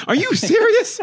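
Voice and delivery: high laughing squeal of disbelief; incredulous laughing yelp. Real, not acted.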